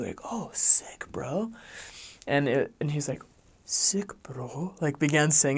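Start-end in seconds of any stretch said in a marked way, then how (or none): 0.2-1.5 high, awed "sick, bro"; impressed slang. Shown as a demonstration. Elder explains slang to English class, impressed demo. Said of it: "that's really cool". 3.7-4.8 imitated murmur, trailing into whisper; under his breath. Husband practices new slang to himself, imitated under-breath murmur. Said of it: "under his breath".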